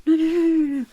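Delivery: high, loud flustered protest; panicked no. Acted. Goblin merchant stopping the customer from visiting other stalls, panicked. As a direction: blurt it out to stop them leaving.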